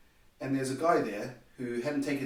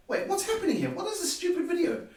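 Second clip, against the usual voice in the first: high incredulous exclaim; mock confusion at the video; baffled disbelief.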